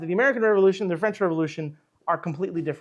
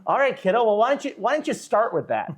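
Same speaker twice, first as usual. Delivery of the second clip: higher, louder mock voice of a patronizing army officer; condescending officer; 7 semitones higher, 5 dB louder than usual. Continental Army officer humoring the eager young Lafayette, loud mock voice. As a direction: brush off the eager kid with a big hearty grin.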